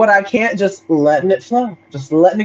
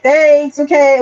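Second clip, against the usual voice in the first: sarcastic thanks; 6 semitones higher, 3 dB louder, slower than usual. Retold or imitated. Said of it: "I'm being sarcastic sometimes".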